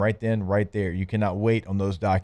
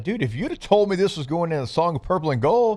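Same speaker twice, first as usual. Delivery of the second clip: lively, pitched-up excited delivery; animated; 6 semitones higher than usual. Retold or imitated. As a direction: talk it up with energy. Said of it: "I was like".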